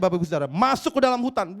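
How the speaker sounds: normal talking voice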